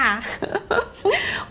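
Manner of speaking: real high-pitched giggle; giggling fit, high and breathless; high squealing laugh. Real, not acted. Laughing at a student comment.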